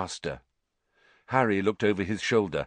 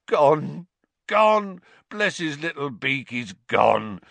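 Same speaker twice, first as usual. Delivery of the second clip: higher, tearful howl; sobbing with joy; 5 semitones higher, 3 dB louder, slower than usual. Acted. Hagrid overjoyed Beaky escaped, sobbing cries. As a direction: howl it through sobs. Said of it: "Hagrid's words through his sobs".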